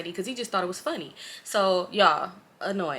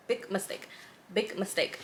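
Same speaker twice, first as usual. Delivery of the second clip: ominous quiet; 9 dB quieter than usual. Real, not acted. Ominous aside.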